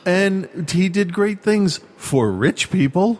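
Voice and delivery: slow, high-pitched incredulous retort; mock inner yelling. Answering praise of Trump's great things, exasperated mock yell. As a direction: draw it out high, as if yelling inside. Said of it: "I'm yelling in my head".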